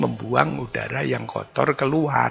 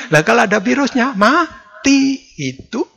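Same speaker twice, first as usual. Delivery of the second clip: triumphant; 9 semitones higher, 6 dB louder than usual. Acted. Preacher dramatizes virus dying.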